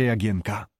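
plain reading voice

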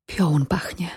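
hushed, fearful whisper; alarmed whisper. A narrator's reading. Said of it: "szepnęła zatrwożona".

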